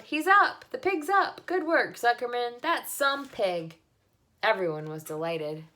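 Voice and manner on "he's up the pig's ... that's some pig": high, loud excited cheering; cheering crowd. A narrator's reading. The crowd cheers the revived pig, excited shouting. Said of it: "yelled the crowd".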